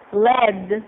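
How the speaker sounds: led said with a short e, like bed; led rhymes with red; led the metal. Shown as a demonstration. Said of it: "which is pronounced".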